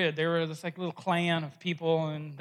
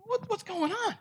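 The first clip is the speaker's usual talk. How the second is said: baffled; 13 semitones higher, 5 dB quieter than usual. Acted. Druids baffled by the troll.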